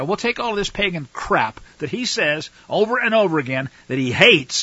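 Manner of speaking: ordinary talk, nothing marked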